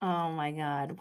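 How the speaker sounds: ordinary delivery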